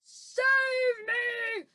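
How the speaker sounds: long, high, loud scream; screamed plea. Acted. Giant trapped in a nightmare, screaming for rescue. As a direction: scream for help, stretching both words. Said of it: "screamed the flesh lump eater".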